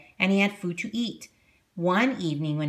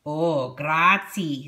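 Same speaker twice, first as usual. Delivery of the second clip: put-on slow, eager young helper; Big Anthony voice; 2 semitones lower, 3 dB louder, much slower than usual. A narrator's reading. Big Anthony thanks Strega Nona, slow and eager. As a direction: draw out a grateful thanks. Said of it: "said Big Anthony".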